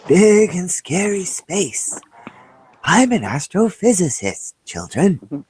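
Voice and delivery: Michio Kaku impression. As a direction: tell it like a spooky bedtime physics tale. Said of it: "I got Michio Kaku keeps coming through".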